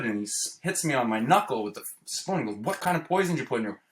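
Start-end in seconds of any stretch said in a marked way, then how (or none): 2.6-3.8 fast, higher, pressing retold question; impatient repeat. Father repeating his question after the spoon hit, fast and impatient. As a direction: snap the question again, impatient.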